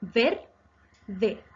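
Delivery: "Ver-de" as two beats with a pause between; teacher dividing "Ver-de" into syllables. Shown as a demonstration. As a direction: separate "Ver" clearly from "de". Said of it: "Se divide también en dos sílabas".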